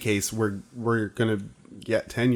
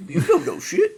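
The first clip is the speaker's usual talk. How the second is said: laughing mimicry. Mocking repeat, laughing.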